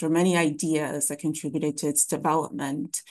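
ordinary talk

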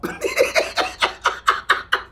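high breathy laugh. Real, not acted. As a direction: laugh in disbelief. Laughing at Hind/India mixup.

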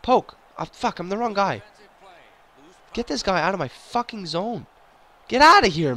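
high-pitched exasperated yelling; frantic frustration. Real, not acted. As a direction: yell at your players in a panic. Frantic defending in hockey game.

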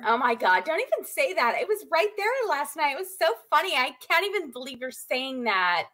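put-on high gushing Valley Girl; high Valley Girl accent. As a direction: gush high like an eighties mall girl. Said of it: "have a conversation ... in Valley Girl talk".

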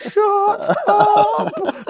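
high, laughing shut up; laughing embarrassed protest. Retold or imitated. Embarrassed at being hyped up.

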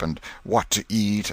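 plain reading voice

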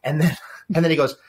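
ordinary talk, nothing marked

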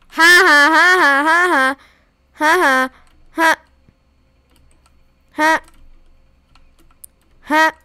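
loud triumphant cackling; gloating laugh. Real, not acted. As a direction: cackle as if the win is yours. Gamer gloats over a win.

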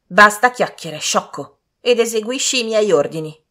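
loud, high imperious rebuke; angry scolding. A narrator's reading. Lady scolding the servant, loud angry order. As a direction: cut the servant off loudly.

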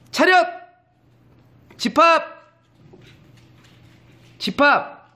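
high barking shout of attention; mock drill sergeant yell. Owner yelling commands at the puppies, mock drill shout.

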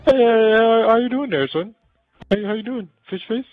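high, silly Kermit-like puppet voice; goofy frog puppet talking. Pretend. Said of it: "This guy has to be like Kermit".

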